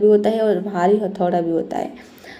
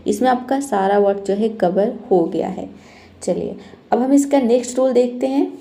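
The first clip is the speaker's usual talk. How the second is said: usual speaking voice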